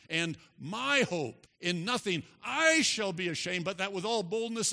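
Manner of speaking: forceful pulpit reading; emphatic preaching. Acted. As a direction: proclaim the verse, punching key words. Preaches a scripture passage forcefully.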